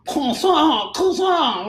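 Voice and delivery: very high, loud French "croissant"; exaggerated Frenchified kwah-sahn; mock French accent. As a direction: ridicule the French pronunciation. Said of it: "pronounce everything like he's French".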